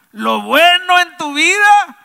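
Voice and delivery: high, loud drawn-out declaration; emphatic proclamation. Real, not acted. Preacher telling congregation whom to thank for good, emphatic proclamation. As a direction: proclaim it at full force.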